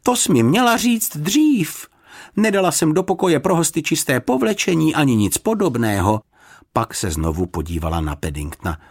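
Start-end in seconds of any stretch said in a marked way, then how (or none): 0.0-6.2 high, fussing protest; mildly scolding housekeeper, pitched up; dismayed exclamation. A narrator's reading. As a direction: complain in a raised, flustered voice. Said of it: "vykřikla paní Ptáčková".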